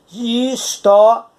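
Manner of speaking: "história" drawn out slowly, syllable by syllable, rising at the end; slow syllable demo. Shown as a demonstration. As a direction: stretch "história" slowly so the ditongo "ria" stands out. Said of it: "Esse ria é uma sílaba". ("história" dita bem devagar, sílaba por sílaba)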